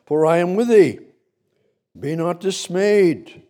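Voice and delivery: high, loud preaching; booming scripture. Acted. Preacher voicing God's promise to the church, high and loud.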